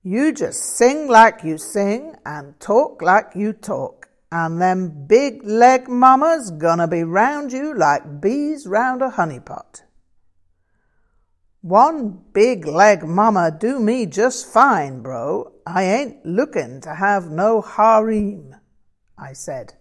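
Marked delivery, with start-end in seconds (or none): 0.0-9.8 loud, raised American blues voice; rolling, emphatic bluesman patter; encouraging American bluesman. Acted. Voices the character Frank. 11.6-18.6 imitated American bluesman accent. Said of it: "as close as I could make it to Frank's accent".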